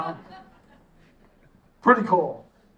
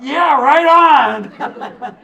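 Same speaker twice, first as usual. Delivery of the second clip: laughing approval; 5 semitones higher, 9 dB louder, much slower than usual. Retold or imitated. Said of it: "But I just thought".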